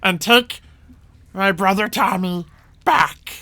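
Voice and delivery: commanding goblin boss, deliberate pauses; lightly voiced goblin giving orders; goblin chief. Goblin chief orders party to bring his brother back, commanding.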